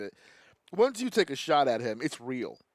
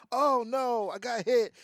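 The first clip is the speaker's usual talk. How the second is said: higher, put-on alarm; badly faked victim's cry; feigned dismay; 6 semitones higher than usual. Movie character pretending the graze was bad, feigned dismay.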